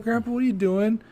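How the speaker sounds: put-on high, innocent little-kid question; a hint of the toddler asking grandpa what he's doing. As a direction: play the curious two-year-old.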